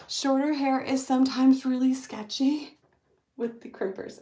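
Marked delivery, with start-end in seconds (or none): none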